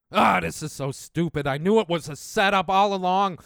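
ordinary talk

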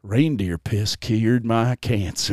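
low, slow, soft testimonial; a deadpan fake believer; understated mock testimonial. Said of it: "joke quote".

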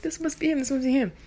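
high breathless anticipation; hope hard it's the call; eager. Retold or imitated. Teen grabbing the phone, eager and high.

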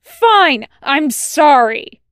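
high, loud, grudging apology; angry shout. A narrator's reading. Wash gives in to Tucker, shouting. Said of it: "Wash shouts".

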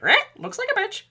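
high squawking parrot. Pretend. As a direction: screech it like a parrot. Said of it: "I'm like".

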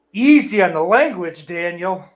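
loud, high mock telling-off; mock scolding. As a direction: playfully chide him for swearing. Mock scolding a chatter for swearing.

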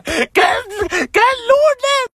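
lloriqueo agudísimo y alargado; llanto exasperado actuado. Retold or imitated. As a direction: reclama llorando de forma exagerada, estirando las palabras. Llanto exasperado por la respuesta. (berrinche lloroso muy agudo)

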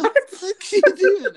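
high, loud incredulous squawk; mocking buddy voice. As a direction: squeal it like you can't believe the plate. Buddy mocking his plate.

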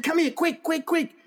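high-pitched, hurried beckoning call; urgent summons. Retold or imitated. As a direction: imitate urgently calling someone over. Acting out urgent call to neighbour.

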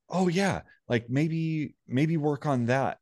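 high, sing-song imitation of the girlfriend; mock girlfriend voice. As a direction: lightly parody her cheerful suggestion. Girlfriend's suggestion quoted back.

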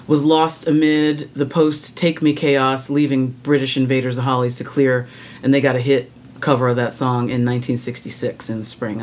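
ordinary talk, nothing marked

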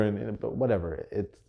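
ordinary talk

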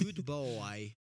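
drawn-out mock Russian; put-on Russian accent pet praise. Said to dog Apollo, playful Russian accent. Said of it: "can't turn on the Russian accent off".

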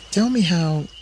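high-pitched coaxing; seductive Eve. A narrator's reading. Eve whispering at Adam's ear, high coaxing voice. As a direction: draw the answer out of him, pitched high.

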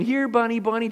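sing-song retold "here, bunny"; coaxing call. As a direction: replay the silly bunny call. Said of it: "I was going".

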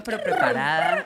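slow squeaky high tease; teasing a friend about being overprepared; teasing squeal. Pretend. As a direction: draw it out way up, mock-eager. Teasing the eager actress.

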